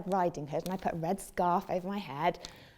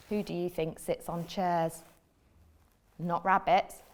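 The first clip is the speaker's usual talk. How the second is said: usual speaking voice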